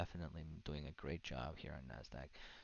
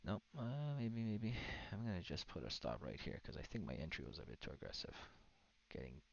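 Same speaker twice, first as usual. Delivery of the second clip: usual speaking voice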